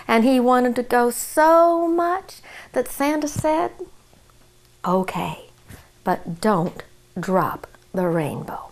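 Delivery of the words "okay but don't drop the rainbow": lower, softer, slow near-whisper; soft warning. A narrator's reading. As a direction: hush the warning gently. Santa quietly agrees.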